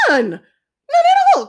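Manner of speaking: high, loud, drawn-out protest; acted high-pitched iguana outcry; iguana's crying denial. A narrator's reading. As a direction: cry out your innocence. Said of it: "cried the iguana".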